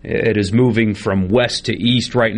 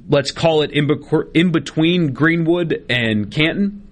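ordinary talk

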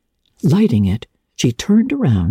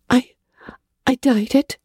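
hesitant; 12 semitones higher than usual. A narrator's reading. Anne confessing guiltily.

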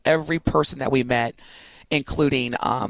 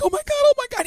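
high excited squeal, a little louder; squealing oh my God; 3 dB louder, much faster than usual. Retold or imitated. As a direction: gush like a starstruck fan. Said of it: "And I was like".

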